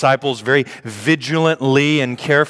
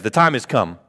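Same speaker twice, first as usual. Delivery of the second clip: normal talking voice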